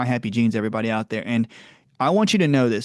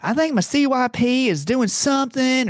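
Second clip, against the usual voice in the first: mock Southern patient; 12 semitones higher, 3 dB louder than usual. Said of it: "I'm not making fun of the South".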